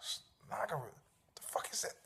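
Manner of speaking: breathless unvoiced confusion, as if alone with the dictionary; whispered. Someone looking up a fake word, whispering in disbelief. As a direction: whisper the puzzled curse under the breath. Mock reader puzzling over word.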